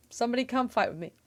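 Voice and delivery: normal talking voice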